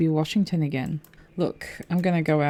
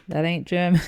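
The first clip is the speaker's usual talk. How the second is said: louder, indignant disbelief; a startled, louder protest; surprised; 4 dB louder than usual. Real, not acted. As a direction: catch the wrong flag out loud. Reacting to a wrong guess.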